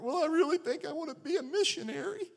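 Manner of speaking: very high mock-weepy girl voice; high, whiny imitation of tearful confession; retold tearful girl. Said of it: "she was crying".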